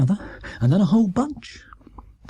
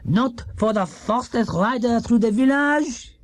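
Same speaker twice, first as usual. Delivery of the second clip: put-on high French-accented announcement; mayor's speech; 6 semitones higher than usual. A narrator's reading. The mayor presenting Paddington's prize to the cheering village. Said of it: "he said".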